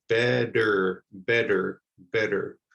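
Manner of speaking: "better" with no T, said like a D; flap T. Shown as a demonstration. Teacher drilling "better" with a flapped T. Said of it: "You don't hear the T at all".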